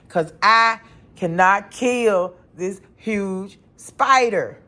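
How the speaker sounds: mock wail, loud and drawn out; retold crying. Woman crying to hubby over killing the spider, mock-tearful. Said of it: "I'm just crying".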